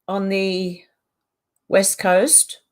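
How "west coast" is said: normal talking voice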